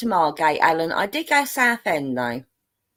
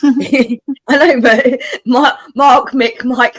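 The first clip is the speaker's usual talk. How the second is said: talking through laughter, louder; laughing; 4 semitones higher, 8 dB louder, much slower than usual. Real, not acted. Guest laughing at muddling the listener's name. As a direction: laugh as you say it. Laughing at her own mistake.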